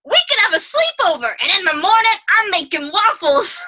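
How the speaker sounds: eager sidekick inviting himself over; mock-excited Donkey. Donkey voiced in retold scene.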